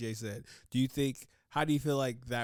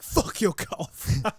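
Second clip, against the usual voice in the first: loud, higher-pitched comic outburst; mock rude server; 7 semitones higher, 8 dB louder than usual. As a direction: snap at a customer for laughs. Acted rude server insulting a diner.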